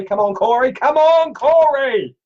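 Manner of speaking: high, loud, playful call. Real, not acted. Streamer urging viewer Corey to ask his question, loud call.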